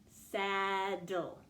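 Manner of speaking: "Saddle" drawn out as saaa-dul; stretched out. Shown as a demonstration. Teacher lets pupils hear the syllables, "Saddle" stretched out. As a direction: hold the vowel of "Saddle", then dul. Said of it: "listen for the vowel you hear".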